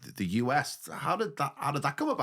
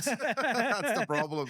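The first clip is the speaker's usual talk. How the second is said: laughing; 10 semitones higher, much slower than usual. Real, not acted. Laughing aside.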